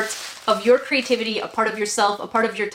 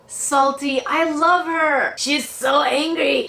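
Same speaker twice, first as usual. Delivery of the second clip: usual speaking voice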